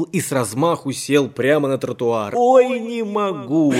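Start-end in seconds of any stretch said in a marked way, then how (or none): 2.3-3.7 slow, high laughing outcry; roaring with laughter. Acted. Shchur collapsing with laughter on the pavement, slow and high. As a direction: burst out laughing, clutching your belly. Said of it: "загремел он, хватаясь за живот".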